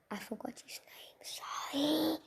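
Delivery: sheepish little whisper, breath only; whispered aside. Real, not acted. Apologising to the fans for forgetting a name, in a whisper.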